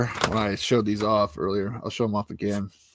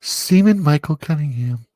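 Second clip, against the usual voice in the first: mock title; 5 semitones higher, 4 dB louder than usual. Mocking friend's new Navy rank.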